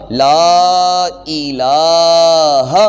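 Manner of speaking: لا الہ slowly chanted, both لام held an alif long; full recitation. Shown as a demonstration. Teacher chants the complete لا الہ for students. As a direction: intone لا الہ slow and drawn out. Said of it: "مکمل تلفظ بنے گا".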